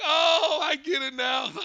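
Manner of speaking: loud, high stoner realization breaking into laughter; mock stoner catching on, laughing; stoner guy. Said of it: "he gives this big stoner fucking laugh".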